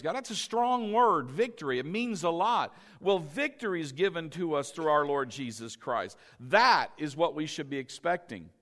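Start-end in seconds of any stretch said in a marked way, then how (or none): none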